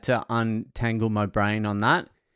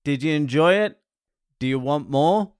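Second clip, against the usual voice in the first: ordinary talk, nothing marked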